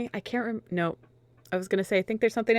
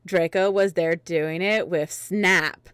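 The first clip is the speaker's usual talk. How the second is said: ordinary talk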